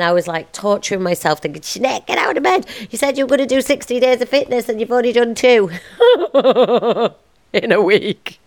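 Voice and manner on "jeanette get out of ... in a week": higher, faster, laughing self-nag; mock self-scolding. Host imitating her own inner critic, laughing. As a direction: nag yourself out of bed, laughing at it.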